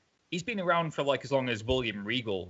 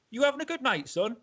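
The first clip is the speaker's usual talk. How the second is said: much higher-pitched retold greeting; imitated friendly wrestler; 13 semitones higher than usual. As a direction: chirp it as a wrestler greeting a young fan.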